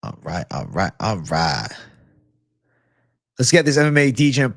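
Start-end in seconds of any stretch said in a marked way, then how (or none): 0.0-1.7 low drawled catchphrase. Acted. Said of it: "low put-on catchphrase voice".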